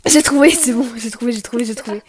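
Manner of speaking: excited. Real, not acted. Solves the quiz, exclaims.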